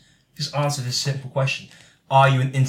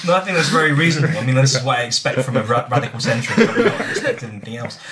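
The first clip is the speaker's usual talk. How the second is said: laughing; 7 dB louder, faster than usual. Real, not acted. Laughing at radical centrist.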